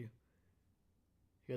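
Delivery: talking through a yawn. Real, not acted. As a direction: stretch the words out in a yawn. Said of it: "Sorry for yawning".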